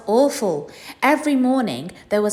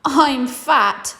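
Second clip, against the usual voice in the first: higher, louder mum voice; Mrs Large's voice; 7 semitones higher, 5 dB louder than usual. Acted. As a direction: play the plump mum, matter-of-fact.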